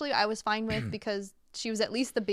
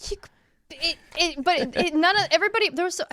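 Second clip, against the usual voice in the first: flustered; 8 semitones higher, 4 dB louder than usual. Real, not acted. Flustered outrage, stammering.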